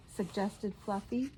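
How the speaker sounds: plain reading voice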